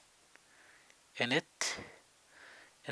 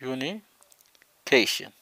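slow, a bit louder, stretched out; slow syllables; 4 dB louder, much slower than usual. Shown as a demonstration. Teacher to class, stretching the word slowly. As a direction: say it for repeating. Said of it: "قسموها إلى ثلاثة أجزاء".

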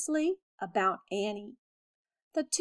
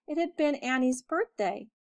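ordinary delivery

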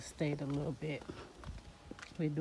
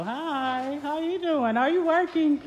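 cheerful calling out; 10 semitones higher, 12 dB louder than usual. Real, not acted. Calling greetings to site workers.

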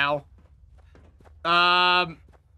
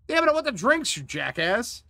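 exasperated outburst; 7 semitones higher than usual. Real, not acted. Game refuses drinks, player protests.

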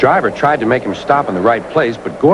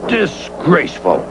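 hissed; 4 semitones higher than usual. A narrator's reading. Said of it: "Gordon hissed".

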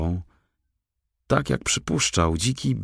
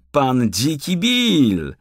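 slow, high, loud mock title, BILL falling; mocking forester; 9 semitones higher, 5 dB louder, much slower than usual. A narrator's reading. Forester mocking the raccoon's fake name, slow mock. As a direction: savour the nickname with scorn. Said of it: "zawołał leśniczy".

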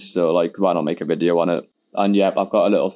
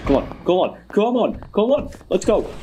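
excited urging; 12 semitones higher than usual. Real, not acted. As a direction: pitch climbing higher with each come on. Racing to escape the monster.

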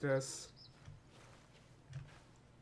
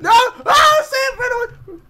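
high, loud frantic cries; hammed-up panic; panicked screaming; 13 dB louder than usual. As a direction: shriek in play-acted terror. Panics as monster nears.

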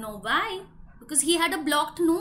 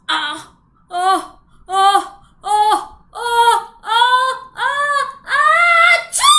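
rising gasps exploding into a long achoo; huge fake sneeze; 7 semitones higher, 11 dB louder, much slower than usual. Storyteller sneezes with children for Gajapati, hugely loud. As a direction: build higher and louder to an enormous sneeze. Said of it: "loudest sneeze".

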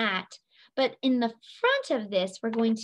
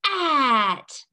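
big, booming storybook giant; loud, drawn-out giant voice; 3 semitones higher, 4 dB louder than usual. Shown as a demonstration. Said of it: "Say it in your big voice".

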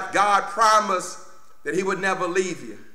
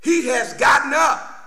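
higher, punched preacher's shout; triumphant proclamation; 8 semitones higher, 5 dB louder than usual. Acted. Angel announces the risen Jesus to the women, triumphant proclamation. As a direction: burst out with the good news.

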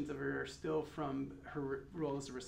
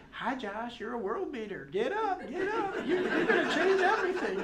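high-pitched, hammed-up Southern accent; mock Southern wake-up call, building louder and higher; [name]'s Southern accent; 12 semitones higher, 9 dB louder than usual. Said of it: "attempt at imitation of a Southern accent".

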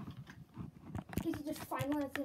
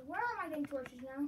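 normal talking voice

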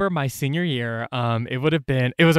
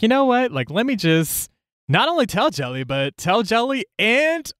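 bold; 7 semitones higher than usual. Retold or imitated. Voicing her bold younger self.

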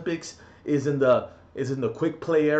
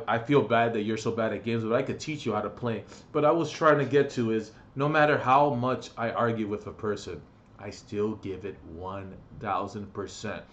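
ordinary talk

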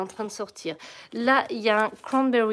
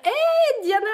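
excited greeting; 14 semitones higher, 6 dB louder, slower than usual. Real, not acted. Greeting a viewer by name.

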